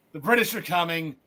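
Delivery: a lightly voiced last Paul Revere cry, winding down; fading Paul Revere call. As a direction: let the alarm trail off. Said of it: "was reminded of Paul Revere".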